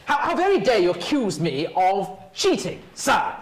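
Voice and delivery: normal talking voice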